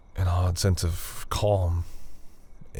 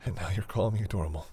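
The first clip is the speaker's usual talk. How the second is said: ordinary delivery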